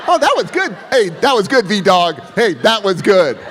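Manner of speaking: high, loud gushing praise; mock the uncool over-eager praise; fawning fan. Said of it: "You're not supposed to go like".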